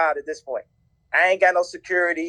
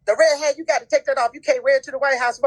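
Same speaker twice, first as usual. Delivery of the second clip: higher-pitched imitation of a fretting wife; higher, urgent mock wife voice; wife's retold objection; 6 semitones higher, faster than usual. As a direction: imitate a wife laying down the rule, voice raised in pitch. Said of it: "then Kim comes to me".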